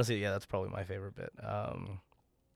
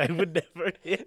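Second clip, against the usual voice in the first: laughing; 8 semitones higher, 5 dB louder than usual. Real, not acted. Echoing with laughter.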